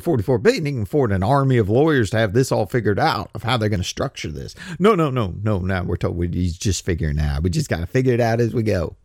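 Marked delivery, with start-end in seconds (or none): none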